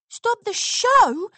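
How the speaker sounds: high, loud startled yelp. A narrator's reading. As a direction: squeak it in disbelief. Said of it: "Scootaloo yelped".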